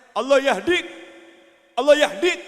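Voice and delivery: loud scolding Allah yahdik; imitating an angry kiai, loud. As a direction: scold a naughty child with a blessing. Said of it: "kalau marah kepada anaknya".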